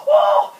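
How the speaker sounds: sharp gasp into high, loud cry; mock hyperventilation with a yelped "oh"; hyperventilating gasp. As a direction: imitate panicked over-breathing. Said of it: "I started to hyperventilate a little bit".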